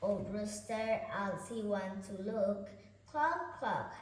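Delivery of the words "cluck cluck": higher clucking; hen clucking. Acted. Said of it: "said the mother hen".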